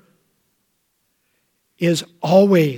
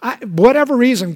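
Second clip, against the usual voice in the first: ordinary delivery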